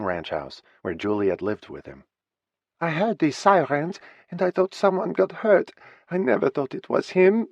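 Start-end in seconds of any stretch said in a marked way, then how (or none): 2.8-7.5 put-on heavy French accent, pitched high; the lost partner in a heavy French accent. Juliet telling of the sirens, high, in heavy French accent. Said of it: "she said in her still heavy French accent".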